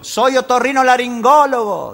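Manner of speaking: high, slow shout into a cave. Acted. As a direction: call the long word out slowly. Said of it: "así gritaba el médico".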